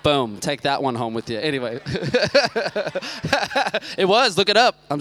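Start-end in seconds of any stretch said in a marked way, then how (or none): 1.8-4.8 laughing. Real, not acted. Laughing after song joke.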